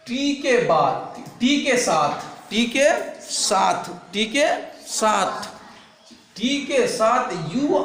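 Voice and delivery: slow, spelled-out teaching chant; slow classroom recitation; slow drill. Shown as a demonstration. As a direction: drill each part slowly for the class. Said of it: "teacher drills rule slowly".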